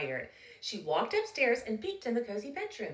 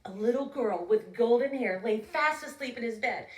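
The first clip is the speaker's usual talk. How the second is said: plain reading voice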